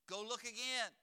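mom ordering. Retold or imitated. Mom calling up the stairs.

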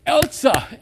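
high, loud mimicked call; imitated dog-owner's shout. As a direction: holler the dog's name as its owner would. Imitates owner calling his dog.

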